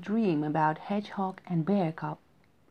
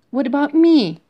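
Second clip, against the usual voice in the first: indignant cry; 6 semitones higher, 13 dB louder than usual. A narrator's reading. Hedgehog feels left out.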